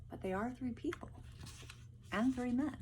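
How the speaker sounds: ordinary talk, nothing marked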